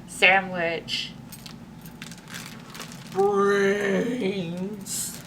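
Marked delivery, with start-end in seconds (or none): 3.1-5.2 zombie moan. A narrator's reading. Librarian voicing the horde's hungry moan. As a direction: drag the word out like a hungry zombie. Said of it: "moaned the zombie horde".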